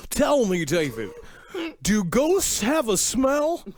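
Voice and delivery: slow, hammed-up radio host curiosity; George Noory. Radio host asking his guest about ghosts, hammed-up wonder. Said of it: "a perfect George Noory impression".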